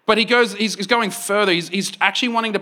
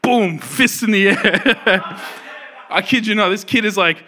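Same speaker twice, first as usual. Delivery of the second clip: normal talking voice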